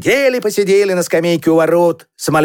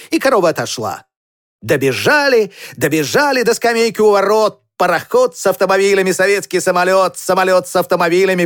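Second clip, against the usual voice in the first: ordinary delivery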